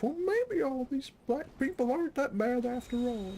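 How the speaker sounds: very high, hammed-up coach voice; high-pitched cartoon old coach; cartoonish movie coach. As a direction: imitate a bigot's over-the-top change of heart. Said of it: "really over-the-top cartoonish coach".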